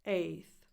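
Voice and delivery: "Eighth" said as ay-th; "Eighth" ending in a clean th; A plus th. Shown as a demonstration. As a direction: run long ay straight into th in "Eighth". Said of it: "A and then the T H".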